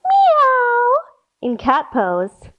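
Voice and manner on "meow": high, louder meow like a cat; squeaky playful cat call. Shown as a demonstration. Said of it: "and say".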